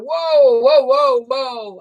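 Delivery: high string of whoas, fading; cousin's startled whoas; mock shocked whoas. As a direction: repeat it, stunned. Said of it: "my cousin Mark went".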